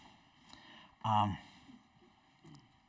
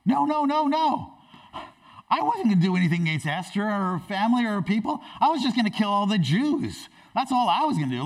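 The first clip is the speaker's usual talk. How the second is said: high, loud, fast backpedalling; shocked panic; 8 semitones higher, 4 dB louder, faster than usual. Acted. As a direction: protest frantically, hammed up. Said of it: "really shocked".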